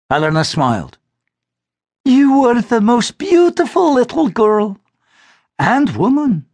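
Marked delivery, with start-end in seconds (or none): 2.0-6.5 higher, slow, doting mother's voice; a mother praising her girl tenderly; fond mother. Acted. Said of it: "she added".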